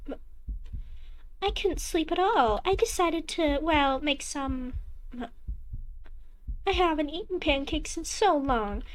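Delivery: a doting mother, casually pleased; cheery mother voice. Acted.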